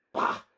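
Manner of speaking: loud dismissive snort; scornful snort. Acted. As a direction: scoff it out. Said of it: "snorted Postumo".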